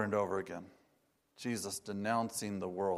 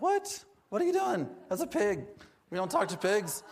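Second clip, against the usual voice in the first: high-pitched, louder incredulous objection; shocked disciples; 10 semitones higher, 3 dB louder than usual. Acted. Disciples object to Jesus blessing a pig, shocked. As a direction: protest in a much higher, aghast voice.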